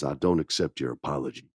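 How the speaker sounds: cold low refusal. Retold or imitated. Roman coldly refusing.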